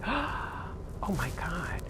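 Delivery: slow, breathless hushed whisper; stunned whisper. Retold or imitated. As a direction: barely breathe it out in shock.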